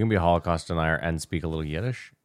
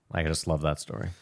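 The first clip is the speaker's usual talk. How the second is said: ordinary talk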